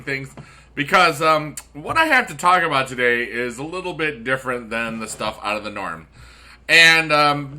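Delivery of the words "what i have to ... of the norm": ordinary delivery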